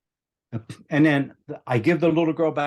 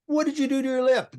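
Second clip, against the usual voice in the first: put-on gentle voice to a child; 13 semitones higher than usual. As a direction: coax a frightened child. Soothing a bleeding little girl.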